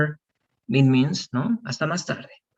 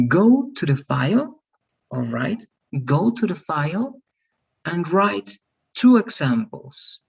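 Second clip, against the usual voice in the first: slow instruction; 4 semitones higher, slower than usual. Shown as a demonstration. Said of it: "giving instructions slowly".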